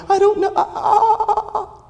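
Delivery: mock the youth's high, giggly, helpless stammer; flustered teen. Retelling the youth's panic to the congregation, a high, nervous, laughing squeak.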